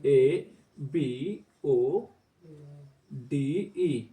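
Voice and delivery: letter by letter, very slow; slow spelling aloud; spelled out slowly. Shown as a demonstration. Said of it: "इसको बनाना है ओ".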